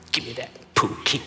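petty grumble. Retold or imitated. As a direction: act out grudgingly asking, under the voice. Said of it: "I'm still being petty".

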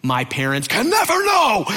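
high-pitched mock freak-out; retold panic, climbing high on "never"; panicked. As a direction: squeal it in hammed-up desperation. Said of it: "full freak out panic mode".

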